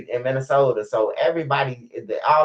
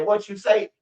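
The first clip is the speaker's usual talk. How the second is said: high challenging comeback, pitched up; imitating Laura Lightfoot; 7 semitones higher than usual. Laura Lightfoot answering mama's insult, high indignant retort. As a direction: snap back high, offended. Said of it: "Laura Lightfoot say".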